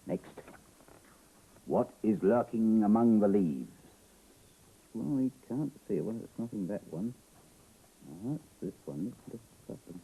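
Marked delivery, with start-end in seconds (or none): 1.6-3.7 loud, drawn-out suspense question; dramatic story reading. A narrator's reading. Reader asks who hides in the leaves, dramatic and slow. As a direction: stretch it slowly and project.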